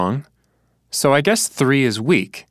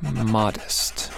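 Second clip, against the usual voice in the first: modest said much softer while writing; murmured to self; 7 dB quieter than usual. Shown as a demonstration. Teacher sounding it out while writing, soft. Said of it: "protest and modest".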